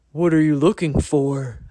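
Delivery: a deer stepping from the thicket. A narrator's reading. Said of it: "asked Deer".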